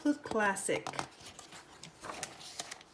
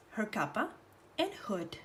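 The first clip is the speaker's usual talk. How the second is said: plain reading voice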